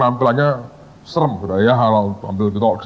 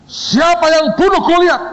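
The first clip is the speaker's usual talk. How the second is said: booming question. Retold or imitated. Founder booming the Goliath question at a student. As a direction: boom the question down at him.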